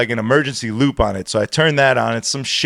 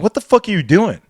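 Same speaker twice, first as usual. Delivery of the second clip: retold angry challenge, pitched high; mad; 7 semitones higher than usual. DJ confronting the kid who cut the music, mad. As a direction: act out your indignant demand. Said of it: "Like, I'm mad".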